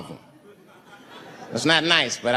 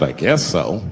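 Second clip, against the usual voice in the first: lower, grave and measured; solemn Native man; 8 semitones lower than usual. Retold or imitated. Navajo man answering the comic's question, low and grave. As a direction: speak with weary dignity.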